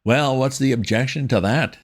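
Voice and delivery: high, bitter toff. A narrator's reading. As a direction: spit it out with resentment. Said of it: "with extraordinary bitterness".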